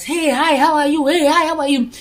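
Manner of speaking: high, sing-out hellos to passers-by; cheery greetings. Retold or imitated. Neighbours greeting the nice guy left and right, cheery greetings. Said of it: "hundred people are greeting him left and right".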